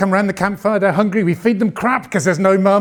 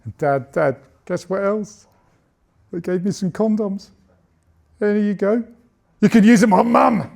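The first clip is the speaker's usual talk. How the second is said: put-on excited child; 4 semitones higher than usual. Son telling dad about school, a hint of a boy's voice. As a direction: offer it up like a proud little boy. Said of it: "he came home from school really excited".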